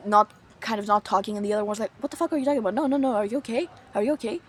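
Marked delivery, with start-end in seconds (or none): 2.0-4.4 shocked then worried. Retold or imitated. Friend shocked then concerned.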